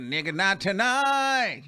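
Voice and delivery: slow, drawn-out sassy no; sassy refusal. Retold or imitated. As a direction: lay it down slowly with attitude. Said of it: "She said".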